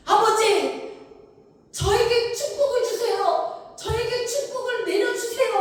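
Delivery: loud, high-pitched crying out; begging at full voice; wailing plea. Acted. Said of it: "막 에서가 울부짖는 거예요".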